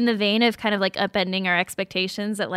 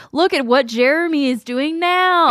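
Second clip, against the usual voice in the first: mock presenter voice; 6 semitones higher, 5 dB louder than usual. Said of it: "It was like".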